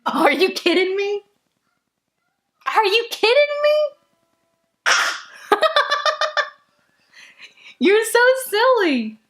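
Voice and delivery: sing-song high cooing. Real, not acted. Cooing at her baby.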